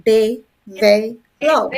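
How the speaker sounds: De, ve, love as three separate beats; syllable by syllable. Shown as a demonstration. Teacher counting three syllables, each said apart. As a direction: break develop into De - ve - love. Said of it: "it has three syllables".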